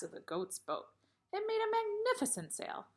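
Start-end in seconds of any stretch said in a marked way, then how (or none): 1.3-2.9 proud. A narrator's reading. George proud of his gift.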